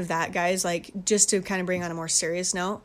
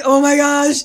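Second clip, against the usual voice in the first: frightened; 8 semitones higher, 13 dB louder, slower than usual. Retold or imitated. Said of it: "I'm like".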